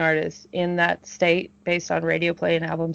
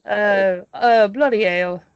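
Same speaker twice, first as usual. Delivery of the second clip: British accent; 2 semitones higher, 4 dB louder, slower than usual. Shown as a demonstration. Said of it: "What about your best English impression?".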